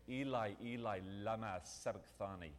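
lightly put-on Aramaic accent; a touch of an Aramaic accent; attempted Aramaic accent. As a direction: quote it with a careful hint of Aramaic accent. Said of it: "in his best Aramaic accent".

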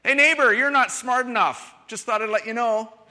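a neighbor hollering insults across the yard, hammed up; mock rude shout. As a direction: holler the insult over the fence, mock cheerful. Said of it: "or that would be a mess".

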